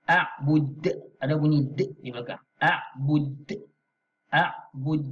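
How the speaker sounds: a'bud with a bouncing d at the end. Shown as a demonstration. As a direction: let the final d of a'bud bounce. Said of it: "bacaan melantun". (a'bud dengan qalqalah, huruf d melantun)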